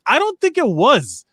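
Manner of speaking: high-pitched doubtful pushback; retold disagreement. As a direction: push back, voice lifted high. Retells own reply in the dispute.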